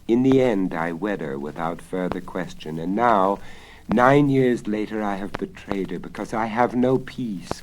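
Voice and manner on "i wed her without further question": usual speaking voice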